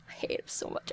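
breathy whisper of hatred; real exasperated whisper. Real, not acted. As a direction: hiss it under your breath. Whispered aside.

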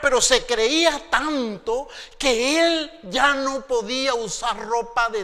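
high, loud, slow preaching build; full-force preaching. Acted. Preacher thundering at the congregation. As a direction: preach it with rising fire.

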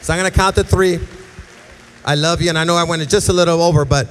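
full-force preaching. Acted. Preaching to the room.

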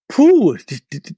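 loud, high puff of being stumped; real overwhelmed puh. Real, not acted. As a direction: blow out air, caught off guard. Stumped by a hard question.

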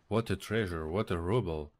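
ordinary talk

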